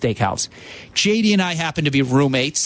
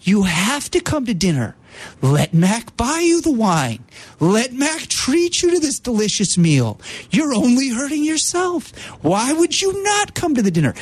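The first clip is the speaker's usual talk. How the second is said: rising ever higher, gentle coaxing; calming high voice; 7 semitones higher than usual. Retold or imitated. Roommate urges him to come to dinner, high and soothing. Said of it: "J D in that voice, that calming, high voice".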